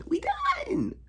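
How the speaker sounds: high, breathy crying whisper; a half-joking sob whispered up high; whispered mock sob. Real, not acted. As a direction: let the words break into a fake sob. Repeated as a broken cry.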